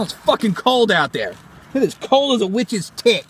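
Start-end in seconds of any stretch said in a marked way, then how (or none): none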